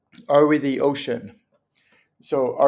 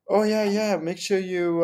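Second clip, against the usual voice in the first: lightly put-on, higher know-it-all voice; a hint of a casual tipster, pitched up; imitated advice-giver; 6 semitones higher than usual. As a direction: mimic someone passing on a tip. Voices somebody giving crystal advice.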